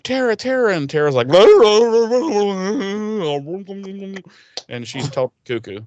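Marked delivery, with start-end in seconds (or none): 0.0-0.7 high worried calls of her name; reaching out to her too late; frantic calling. Retold or imitated. Willow finding Tara after Glory leaves, high frantic calls. 1.3-4.2 long high unhinged giggle; a mad cackle; crazy laugh. Retold or imitated. Tara after Glory drains her sanity, high crazy giggle.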